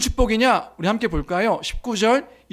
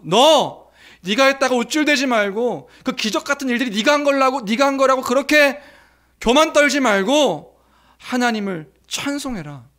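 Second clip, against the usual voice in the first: higher, louder scolding warning; stern rebuke; 4 semitones higher, 3 dB louder than usual. Acted. Priest scolds boastful Abraham, loud stern warning. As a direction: scold him not to boast. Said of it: "이렇게 된 거 아닐까요".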